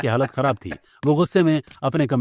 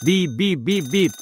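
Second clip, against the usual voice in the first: imitated beeping alarm, higher; fire alarm beeps; 4 semitones higher, slower than usual. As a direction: beep it out like a smoke alarm. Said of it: "fire alarm जोर-जोर से बजने लगता है".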